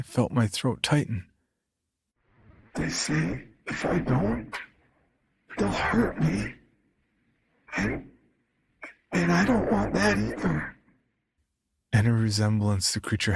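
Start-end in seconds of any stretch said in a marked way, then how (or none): 2.7-10.8 slow, strained and wavering, mostly higher; strained choking voice. Acted. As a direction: force the words out through a choked throat. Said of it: "My father's voice choked".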